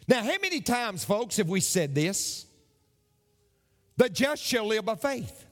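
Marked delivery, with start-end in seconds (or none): none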